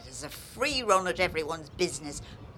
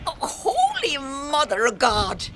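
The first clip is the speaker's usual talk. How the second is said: exasperated outburst; 7 semitones higher, 5 dB louder than usual. Acted.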